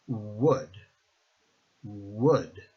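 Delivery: Would begun with a Spanish U glide, "U-ud"; Spanish U start. Shown as a demonstration. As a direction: open Would with a U vowel. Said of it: "it's the same idea".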